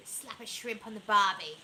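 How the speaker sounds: loud, hammed-up Aussie accent attempt; failed Australian accent. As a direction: have a bad go at an Australian accent. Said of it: "No, I can't do it".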